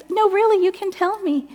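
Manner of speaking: high, earnest coaxing; acted high-voiced sympathy; coaxing friend. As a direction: imitate a friend gently pressing for an answer. Friend coaxing her to talk.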